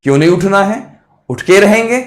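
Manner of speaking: high, loud, determined agreement; won-over brain. Acted. Brain finally agreeing to get up, high emphatic resolve. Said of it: "दिमाग कहेगा".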